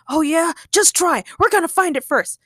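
high, indignant challenge; outraged. Acted. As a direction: throw down a heated dare. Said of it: "Ray was outraged".

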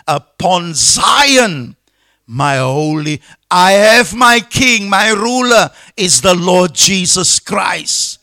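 loud, proclaiming pulpit voice; emphatic, ringing sermon delivery; fervent preaching. Real, not acted. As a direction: proclaim scripture with force. Preaches at full force.

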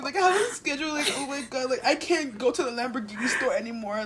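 high-pitched mock distress; hammed-up outrage at trivial inconveniences; overdramatic rich person. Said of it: "They think everything is so dramatic".

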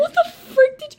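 high, loud, incredulous outburst; loud exasperated protest; outraged shout. Real, not acted. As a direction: explode in disbelief. Outraged at friend's reported remark.

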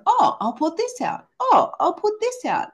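high, bright-eyed eager inner voice; mental chatter voice. Retold or imitated. Mind eagerly planning a product launch, high excited inner voice. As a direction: voice the eager mind pitching ideas. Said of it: "my mental mind going".

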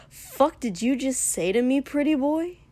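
angry demand. A narrator's reading. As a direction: throw it back as a threat. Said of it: "Katsuki demanded".

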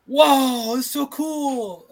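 high, slow, overdone fake amazement; unconvincing cover story; nervous bad liar. Shown as a demonstration. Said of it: "She's the worst liar ever".

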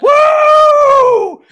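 high, loud drawn-out whoop; gleeful whoop. Acted. Game master mimics player throwing loot, gleeful whoop. Acting out a player tossing things.

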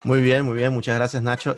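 ordinary delivery

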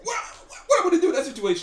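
high, loud, rapid comeback; flustered protest. Real, not acted. Defending his choice in the kidnapping scenario, high flustered protest. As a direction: fire back defensively, half laughing. Defending himself against friend's challenge.

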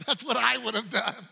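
normal talking voice

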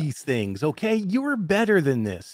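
wag a finger at the writers; mock scolding. Scolding the news site for its clickbait title, pleading.

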